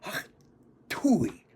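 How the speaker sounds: breathy hawk-and-spit noise; boy imitating spitting on a school wall; mock spit sound. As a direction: hack and spit it out. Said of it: "and I just went".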